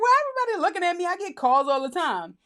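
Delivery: mock bewildered self; confused. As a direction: rush it out puzzled. Confused as office stares.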